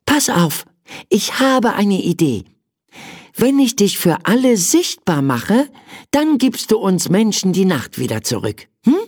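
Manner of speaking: put-on high-pitched child with an idea; little boy. A narrator's reading. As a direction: propose a deal as a young boy. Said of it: "Michael nachdenklich und sagte dann". (hohe Kinderstimme, vorschlagend)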